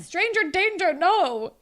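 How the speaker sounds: loud, high-pitched retold warning; mock alarm. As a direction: call out a warning, hammed-up. Retold friend sounding the alarm.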